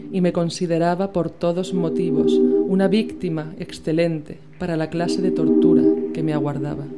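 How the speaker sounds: ordinary delivery, nothing marked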